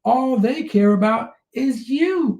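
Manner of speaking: high, drawn-out emphatic point; impassioned and pitched high; fired up. Real, not acted. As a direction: stretch the words, all worked up. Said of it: "He's fired up today".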